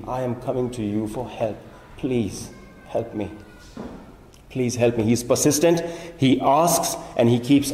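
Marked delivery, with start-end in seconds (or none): 0.0-5.0 softer, lower, earnest begging; hushed plea. Acted. Father begging Jesus to heal his son, softly pleading.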